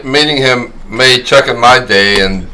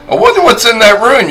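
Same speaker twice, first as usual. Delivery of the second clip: retold musing in a higher voice; curious wondering; 10 semitones higher than usual. Quoting a friend eyeing the back room, curious. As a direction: lilt it up, playfully curious.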